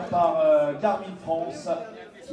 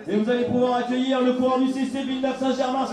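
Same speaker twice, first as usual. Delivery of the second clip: climbing higher and louder to the winner's name; building announcer hype; 6 semitones higher, 4 dB louder than usual. Acted. Announcer calling the local climber to the podium, building to a shout.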